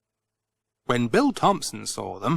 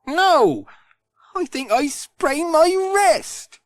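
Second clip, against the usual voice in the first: higher, slow pained complaint; hurt and sorry; 4 dB louder, slower than usual. Acted. Pat tells Alf he sprained his wrist, pained. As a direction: wince through it.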